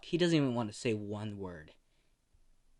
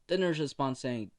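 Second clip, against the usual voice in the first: plain reading voice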